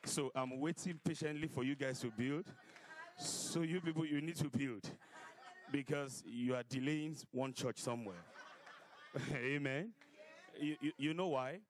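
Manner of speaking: loud, joking preacher voice; fiery preacher sermon. Acted. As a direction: tease the congregation loudly.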